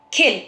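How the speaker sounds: "खिल" with a short i; quick, short "खिल". Shown as a demonstration. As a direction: keep the vowel of "खिल" brief. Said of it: "छोटी की आवाज़".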